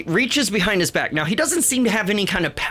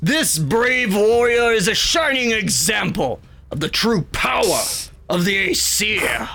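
big, booming god proclaiming to all; 5 semitones higher than usual. Acted. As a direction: boom it out like a proud thunder god, raised pitch. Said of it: "booming voice says".

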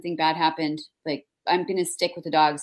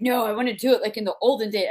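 acted protest; 4 semitones higher, faster than usual. Acting her reply to neighbors.

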